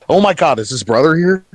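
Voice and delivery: acted jittery alarm; nervous. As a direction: blurt it out, suddenly worried who's around. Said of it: "He kinda looks around all nervous".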